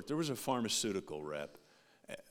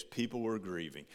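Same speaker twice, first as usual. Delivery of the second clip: ordinary delivery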